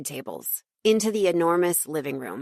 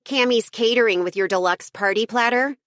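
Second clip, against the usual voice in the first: bright caterer's announcement, higher; calling out; 5 semitones higher, 3 dB louder than usual. Acted. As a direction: announce the delivery cheerfully.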